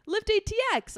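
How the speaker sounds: high, loud excited shout of recognition; imitated fan call. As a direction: call it out like spotting a celebrity. Guy calling out her podcast.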